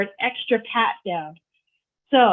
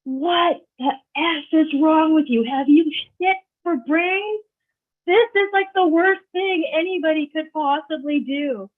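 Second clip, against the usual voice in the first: higher, mock-furious scolding at the daughter; retold angry yell; 7 semitones higher than usual. Mother scolding her daughter for the stunt, high mock-angry yell. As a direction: play the furious mother, pitch climbing high. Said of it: "yells at me and says".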